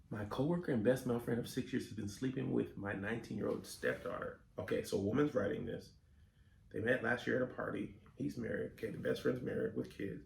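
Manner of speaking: quiet fast muttering to self; muttering reread. Real, not acted. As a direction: mumble it under your breath.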